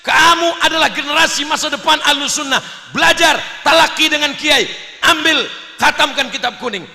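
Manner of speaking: loud, high rallying shout; forceful commands to a crying child; rousing pep talk. Acted. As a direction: shout encouragement like a fired-up father. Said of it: "saya beri semangat".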